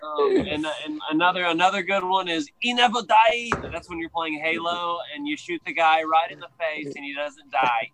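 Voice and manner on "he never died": high, slow Scottish quote; NEVER leaned on in a Scottish lilt; Scottish accent. Retold or imitated. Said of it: "the Scottish accent is not that easy to do".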